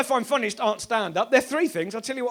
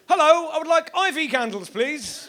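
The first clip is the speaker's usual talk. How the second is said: mock Roman customer; 6 semitones higher, 3 dB louder than usual. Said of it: "Roman, to Ronnie's sketch".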